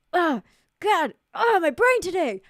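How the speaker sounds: high, loud exasperated outburst; exasperated groan. Real, not acted. Streamer losing the thread mid-sentence, loudly exasperated. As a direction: groan out loud at your own failing brain.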